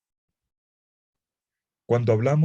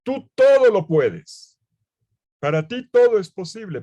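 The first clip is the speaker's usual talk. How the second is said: tearful plea; 10 semitones higher, 8 dB louder than usual. Retold or imitated. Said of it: "y lo dice entre lágrimas".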